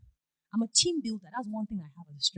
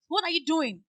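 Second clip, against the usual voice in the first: higher, louder accusing question; angry caller; 6 semitones higher, 6 dB louder than usual. Retold or imitated. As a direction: demand it like an irate customer.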